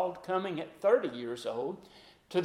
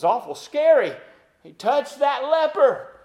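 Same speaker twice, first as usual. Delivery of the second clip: louder and higher, urgent; preacher stressing a bold touch; emphatic preaching; 7 semitones higher, 8 dB louder than usual. Acted. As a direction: make them feel the shock. Preacher stresses the shocking act.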